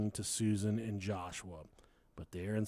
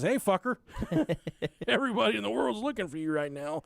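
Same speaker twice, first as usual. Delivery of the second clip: neighbor greeting; 6 semitones higher, faster than usual. Retold or imitated. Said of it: "And the neighbor says".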